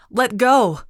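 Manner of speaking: loud, high desperate shout. A narrator's reading. Girl to the woman gripping her wrist, shouting. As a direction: cry out sharply, pitch and volume jumping up. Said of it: "I shouted".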